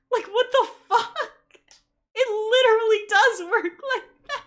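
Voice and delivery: high, loud laughing rant; loud, incredulous complaint at high pitch; exasperated outburst. Real, not acted. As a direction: protest the nonsense, half laughing. Ranting at absurd movie scene.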